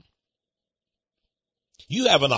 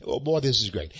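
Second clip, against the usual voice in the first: normal talking voice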